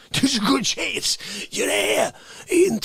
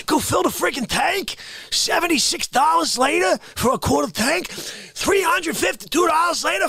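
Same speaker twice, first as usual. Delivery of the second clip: incredulous rant; 7 semitones higher than usual. Acted. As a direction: yell at him like he's lost his mind. Incredulous ranting at the listener.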